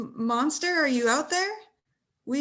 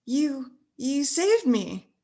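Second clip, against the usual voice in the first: usual speaking voice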